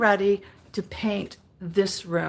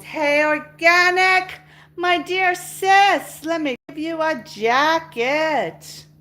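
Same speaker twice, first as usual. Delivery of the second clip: high, slow, loud welcome to a friend; excited greeting; 7 semitones higher, 5 dB louder, slower than usual. Real, not acted. Greeting her friend Organic as she joins, excited and drawn out. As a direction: greet her like a long-lost sister.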